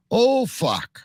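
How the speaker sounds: high, loud startled oh fuck; shocked curse. Retold or imitated. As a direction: blurt it out caught off guard. Retold shocked reaction.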